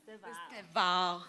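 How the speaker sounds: "Estevão" slowly as Portuguese ste-VAUN; drawn-out "Estevão" with nasal vão ending; Portuguese pronunciation. Retold or imitated. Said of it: "Con gracia brasileña".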